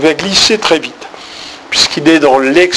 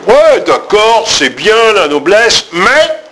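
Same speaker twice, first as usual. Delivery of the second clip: mock concession then high mais; 6 semitones higher, slower than usual. Shown as a demonstration. Teacher playing the speaker who concedes then contradicts. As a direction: grant it grandly, then pivot on mais. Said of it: "je vais dire le contraire".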